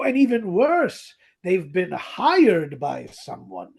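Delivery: ordinary talk, nothing marked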